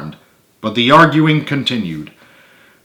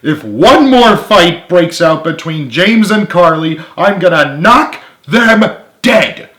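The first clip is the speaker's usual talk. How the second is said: higher, louder threat; furious threat; 7 semitones higher, 6 dB louder than usual. A narrator's reading. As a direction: vent the fury. Frank raging.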